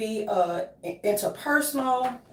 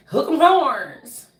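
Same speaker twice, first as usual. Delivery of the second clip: loud, drawn-out team cheer; stretched-out, raised-voice chant; 4 semitones higher, 7 dB louder, much slower than usual. Pretend. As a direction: call it out slowly like a rallying cry.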